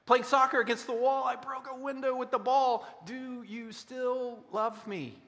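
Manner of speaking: put-on little child voice. Child owns up to a broken window to parent, high little voice.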